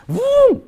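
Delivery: a sudden high, loud "bum" like an engine firing up; mock engine roar. The pastor imitates his car roaring off toward the dying woman, loud and high. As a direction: burst out with the engine noise.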